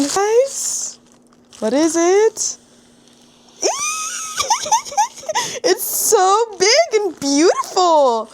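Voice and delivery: high, loud squealing gush; delighted squeal over an unboxed book; excited squeal. Real, not acted. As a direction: squeal over the new book, slowing at the end. Unboxing her new book.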